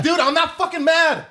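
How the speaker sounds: high, drawn-out emphatic denial; passionate yelling. Real, not acted. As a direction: deny it heatedly, slowing down for emphasis. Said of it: "I'm just yelling passionately".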